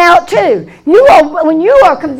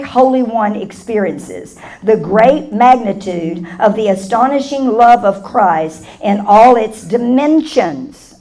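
soft, lower reading over music; scripture reading; 7 semitones lower, 4 dB quieter than usual. Acted. Preacher reading a verse to the congregation, soft and low. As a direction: read the verse quietly and intimately.

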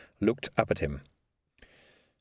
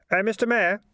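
put-on higher, easy young man; a cocky lad addressing the mayor; 10 semitones higher, 3 dB louder than usual. A narrator's reading. Keith voiced.

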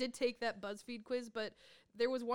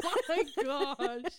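laughing; 4 semitones higher, 10 dB louder, much slower than usual. Real, not acted. Cracking up with co-host.

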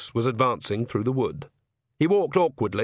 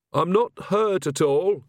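slow, higher and pointed, fishing for sympathy; pointedly; 7 semitones higher than usual. A narrator's reading. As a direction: lay the hurt pride on each word. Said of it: "said Agnes pointedly".

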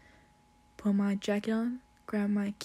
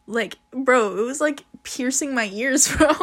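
exclaiming; 4 semitones higher, 10 dB louder than usual. Real, not acted. Describing the loud alarm.

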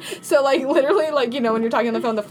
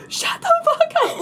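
much higher laughing mock panic; panicked shriek. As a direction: shriek it at the ringing phone. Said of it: "like, in a panic".

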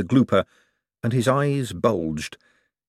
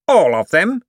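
Cosmo voice; 10 semitones higher, 7 dB louder than usual. Acted. Cosmo surprised.

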